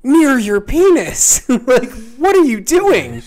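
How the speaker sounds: slow, very high, loud outrage; imitated exasperated shouting; appalled doctor yelling. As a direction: scold in utter disbelief, hammering it out. Doctor retold scolding patient.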